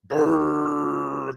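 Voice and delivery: rolled rumbling monster noise; Godzilla roar. Pretend. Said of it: "like Godzilla".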